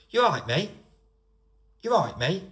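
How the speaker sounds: high, loud regional British greeting; run-together greeting in a regional accent. Shown as a demonstration. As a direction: call it out in a local accent, lifting on "mate". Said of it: "Think about how I've said it".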